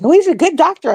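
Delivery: mock trusting trucker; naive friend. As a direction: brush the worry off brightly. Friend defending his doctor, retold.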